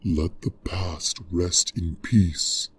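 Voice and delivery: low, slow consoling voice; deep soothing dragon; gentle dragon. Acted.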